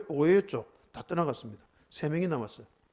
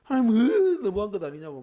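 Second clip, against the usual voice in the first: high, loud choked cry breaking into the complaint; imitated sobbing; 5 semitones higher, 5 dB louder than usual. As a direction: reenact wailing at God, voice cracking on 으. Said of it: "엉엉 울었습니다". (하나님께 울며 따지는 재현된 울먹임)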